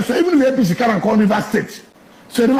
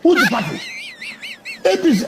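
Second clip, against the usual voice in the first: incredulous outburst. Real, not acted. Mocking rhetorical question.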